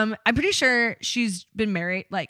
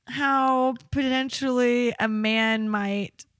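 ordinary talk

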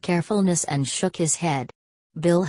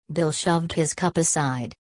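ordinary delivery

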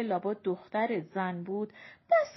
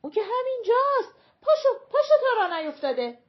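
high, loud and fast urging; urgent alarm; 12 semitones higher, 9 dB louder, faster than usual. A narrator's reading. Mother hurrying passenger off bus, urgent alarm. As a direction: hurry her off before it's too late. Passenger is about to miss stop.

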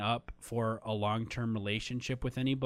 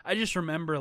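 ordinary talk, nothing marked

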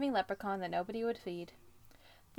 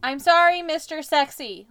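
loud, high hammed-up apology; mock scream of sorry; 6 semitones higher, 12 dB louder than usual. A narrator's reading. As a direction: shout the apology. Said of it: "Lou screamed".

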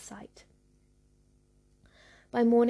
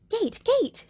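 shrill-high loud call, much higher; crying out; 12 semitones higher, 7 dB louder than usual. Acted. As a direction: shout for the gate like a witch. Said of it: "she cried".

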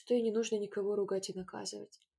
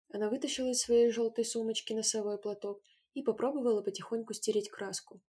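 ordinary delivery

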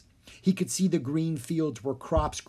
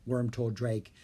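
ordinary delivery, nothing marked

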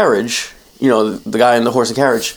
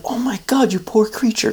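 higher, softer pitying coachman; 8 semitones higher, 5 dB quieter than usual. Retold or imitated. Coachman pities the bird, high and soft. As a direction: coo over the injured bird. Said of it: "He's like".

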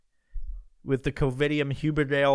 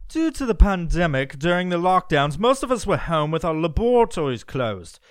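put-on British accent, pitched up a little; 3 semitones higher, 5 dB louder than usual. Reading the scientist's statement about lockdowns, in a put-on British accent. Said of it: "I'm gonna do this in a British accent".

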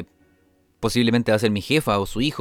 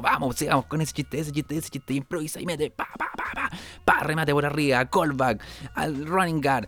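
higher, rapid play-by-play of his own set; hyped self-coaching; 5 semitones higher than usual. Retold or imitated. Comic hosting his set, coaching himself like a fight announcer. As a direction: call the jokes like a boxing match. Fast comedy play-by-play inner voice.

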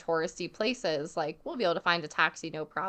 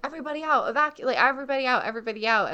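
higher, rushed repeated call to clear the room; urgent order; 6 semitones higher, much faster than usual. Retold or imitated. Security lady hurrying tourists out of the throne room, urgent and fast. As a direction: hurry the crowd out.

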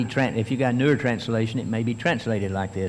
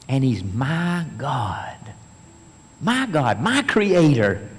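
usual speaking voice

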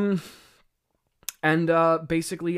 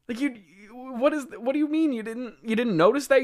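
high-pitched, stammering disbelief; comic outraged questioning, pitched way up; incredulous; 11 semitones higher, 5 dB louder than usual. Real, not acted. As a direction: demand an explanation, utterly baffled. Mock challenge to Adam's obliviousness.

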